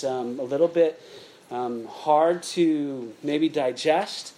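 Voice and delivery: sincere spoken prayer; opening prayer. Acted. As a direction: pray over the room before a hard lesson. Leads the church in prayer.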